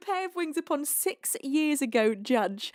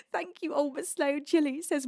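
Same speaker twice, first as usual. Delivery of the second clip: ordinary talk, nothing marked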